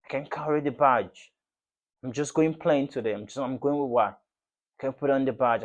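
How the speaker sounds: normal talking voice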